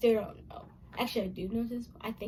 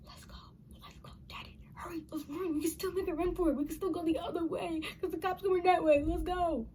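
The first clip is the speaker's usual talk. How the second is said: hushed urgent whisper, mostly breath; retold whisper; 6 semitones higher, 5 dB quieter than usual. Child urging dad to run from cops, in an urgent whisper. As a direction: whisper it fast so nobody hears. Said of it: "and I was whispering".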